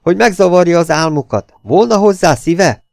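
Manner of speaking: magasan, hangosan, lassan a végén; felháborodott kiáltás, lassan kérdezve. A narrator's reading. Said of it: "kiáltotta Elin". (hangos, magas szemrehányó kiáltás)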